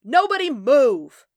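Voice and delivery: very loud, high, drawn-out order; slow, commanding shout; booming command. A narrator's reading. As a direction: boom it out to freeze everyone. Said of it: "boomed a new voice, loud enough".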